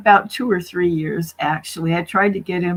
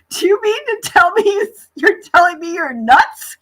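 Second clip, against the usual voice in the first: high, louder question breaking into laughter; incredulous laughing blurt; 10 semitones higher, 5 dB louder than usual. Retold or imitated. Telling him he's nuts while laughing at him. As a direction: blurt it out, already cracking up.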